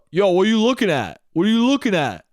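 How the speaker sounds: mock-tough challenge in a put-on voice; hammed-up defensive youngster; confrontational kid. Kid challenges the staring teller.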